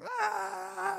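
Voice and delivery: high imitated baby wail; mock newborn screaming; newborn's scream. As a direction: wail like a baby just born. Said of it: "he's screaming".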